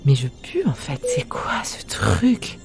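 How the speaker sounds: slow, breathless whisper; disgusted whisper. Real, not acted. Disgusted at own smell.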